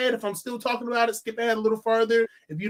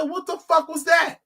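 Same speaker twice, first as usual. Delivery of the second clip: raised-pitch, incredulous exclamation; retold out-loud disbelief; retold outburst; 7 semitones higher than usual. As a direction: call it out as your baffled movie-theater reaction. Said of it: "I audibly out loud said".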